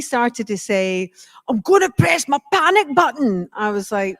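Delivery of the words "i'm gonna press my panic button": high, punchy mock rage; comically worked-up, raised-pitch threat; angry little man. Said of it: "this really angry little man".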